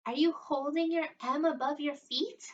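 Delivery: ordinary delivery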